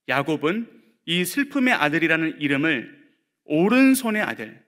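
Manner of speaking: usual speaking voice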